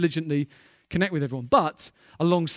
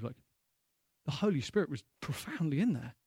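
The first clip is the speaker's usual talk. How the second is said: normal talking voice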